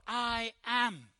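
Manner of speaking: "I am" drawn out with weight on both words; emphatic declaration. Retold or imitated. Jesus stands up and declares who he is, emphatic "I am". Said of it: "he's put real emphasis on it".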